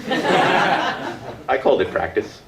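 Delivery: slow laughing punchline. Real, not acted. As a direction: deliver it through a laugh. Punchline after breakdown story.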